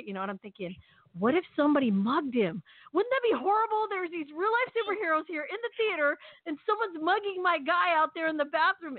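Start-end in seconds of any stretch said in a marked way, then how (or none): 1.2-9.0 higher-pitched, mildly panicky retold worry; mind going crazy. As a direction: let imagined worries run away with you. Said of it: "my mind was going crazy".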